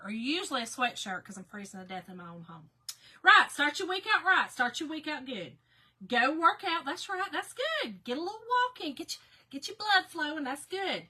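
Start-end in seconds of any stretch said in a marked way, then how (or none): 3.2-11.0 higher, louder, lively encouragement; upbeat cheering-on. Real, not acted. Vlogger cheering a viewer's early workout, upbeat and higher.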